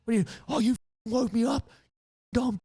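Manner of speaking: high, mocking gym bro ranting; angry gym bro. Teller mimicking the gym bro who attacked the arborists, high and mocking. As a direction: play the attacker as a shrill lout. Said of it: "stepped in like".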